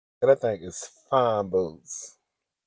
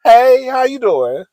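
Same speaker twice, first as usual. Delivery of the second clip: high, loud, drawn-out hello; hammed-up flirty greeting; 12 semitones higher, 12 dB louder, slower than usual. Shown as a demonstration. What she wanted to say to him, gushing flirtation. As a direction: sing out the come-on slowly.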